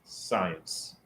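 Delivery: very low, hushed "science"; cringing. Real, not acted. Host muttering a word that makes them cringe. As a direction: say it under the breath with distaste. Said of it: "That word makes me cringe".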